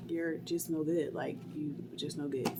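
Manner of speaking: low, soft, cold disappointment; quietly write her off; disgusted. Retold or imitated. Glaring at useless friend, low and cold. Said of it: "I was looking at her like".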